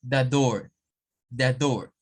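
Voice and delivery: the door with a sounded R, American accent; American accent demo. Shown as a demonstration. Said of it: "un norteamericano le dice".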